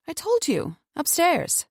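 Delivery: a hint of a frightened prisoner; Cora. Captive answering her captor, lightly tense voice. Cora's voice in dialogue.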